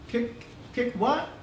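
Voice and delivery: lightly voiced confused little boy; a hint of a puzzled kid, slow. A narrator's reading. Sick boy puzzled by the doctor, lightly voiced.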